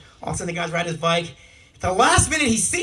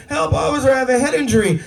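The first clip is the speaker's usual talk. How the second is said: higher mock plea for help; lightly hammed-up cry for help; injured cyclist pleading; 5 semitones higher, 4 dB louder than usual. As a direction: put on a hurt man's plea. Injured cyclist begs officer.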